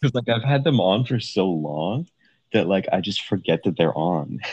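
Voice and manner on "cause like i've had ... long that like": ordinary delivery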